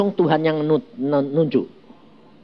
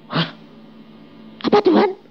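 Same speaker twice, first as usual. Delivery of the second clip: high, sharp "Apa, Tuhan?"; shocked, asking God to repeat; startled question; 13 semitones higher than usual. Retold or imitated. Preacher retells asking God in disbelief, high and startled.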